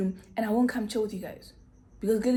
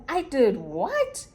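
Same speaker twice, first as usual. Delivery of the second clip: drawn-out, higher-pitched imitation of shock; mock disbelief; 4 semitones higher, much slower than usual. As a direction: stretch it out slowly, mimicking his astonishment. Mimics boyfriend's incredulous denial.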